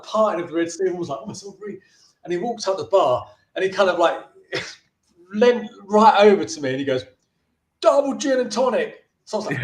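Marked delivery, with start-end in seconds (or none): 1.2-1.8 high, very soft mock crowd murmur; awed hush. Bar crowd as the film star walks in, awed hush. 7.8-9.0 higher-pitched retold drink order; drunken customer's demand. Drunk actor leaning over to the young barman, demanding.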